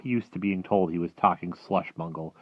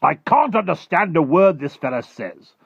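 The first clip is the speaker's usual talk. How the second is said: high, loud, irritated complaint; snapping army chief; 11 semitones higher, 12 dB louder than usual. Acted. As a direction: snap impatiently as an army officer. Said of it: "the head of the army snapped".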